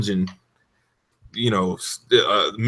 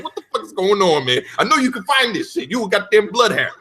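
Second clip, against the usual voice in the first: mock scolding; 8 semitones higher than usual. Scolding the dog.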